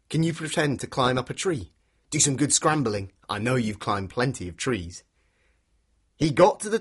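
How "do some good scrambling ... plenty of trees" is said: ordinary delivery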